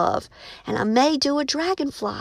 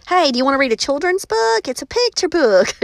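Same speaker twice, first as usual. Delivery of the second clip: higher, louder and fast, selling it; bouncy eager sales pitch; excited pitch; 5 semitones higher, 5 dB louder than usual. Shown as a demonstration. Offering the picture book to a reader, eager and fast. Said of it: "You see the difference?".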